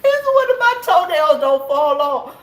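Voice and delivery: high, loud, laughing complaint. Real, not acted. Laughing about sore toes.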